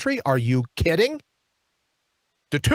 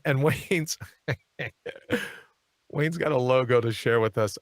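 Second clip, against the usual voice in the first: laughing; 6 semitones lower than usual. Real, not acted. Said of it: "I can't even say Nancy without laughing".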